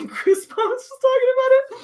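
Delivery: high laughing delivery; laughing through words. Real, not acted. Telling the host about goosebumps, laughing. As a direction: giggle while you admit it. Laughing at her own story.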